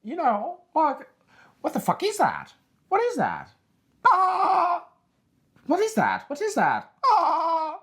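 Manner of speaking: squeaky high cartoon mouse voice, shrieking; mock Tucker Carlson as a cartoon mouse; Mickey Mouse Tucker. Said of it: "he's like Mickey Mouse".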